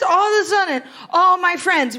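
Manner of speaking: normal talking voice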